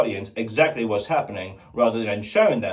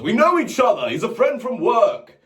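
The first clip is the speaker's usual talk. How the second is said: Chris Hemsworth; 9 semitones higher, 4 dB louder than usual. Retold or imitated. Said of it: "Chris Hemsworth".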